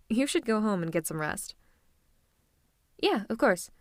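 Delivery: normal talking voice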